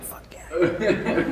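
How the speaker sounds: breathy, giggling whisper; soft giggling aside; whispered laughing yes. Retold or imitated. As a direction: laugh it under your breath.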